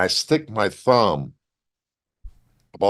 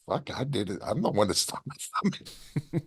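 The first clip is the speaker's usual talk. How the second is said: much softer, muttered to himself; hushed guilty aside; 9 dB quieter than usual. Retold or imitated. Wrestler to himself as Ming apologizes, soft guilty mutter. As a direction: admit it under your breath.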